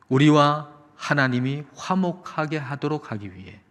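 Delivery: ordinary talk, nothing marked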